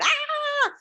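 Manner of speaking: short high squeal of mock panic; inner scream. Author screaming inside at the surprise launch, high squeal. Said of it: "And inside I'm going".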